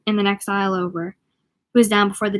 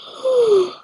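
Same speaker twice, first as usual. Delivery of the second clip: sharp gasp; 13 semitones higher than usual. Real, not acted. Reader startled mid-reading.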